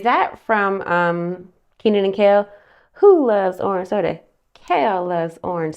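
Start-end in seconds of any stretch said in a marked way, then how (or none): none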